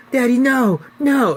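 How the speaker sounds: put-on high, loud little-boy cry; frightened child calling no; child's plea. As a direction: cry out to daddy, pitch way up. Child begging his father.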